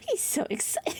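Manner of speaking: said through high giggles; laughing. Real, not acted. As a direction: laugh while squeaking it out.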